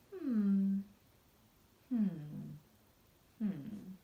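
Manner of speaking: low pondering hmm fading; thinking hum. Acted. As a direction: hum as if deep in thought. Norman pondering.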